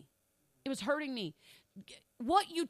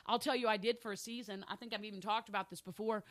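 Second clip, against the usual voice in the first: ordinary talk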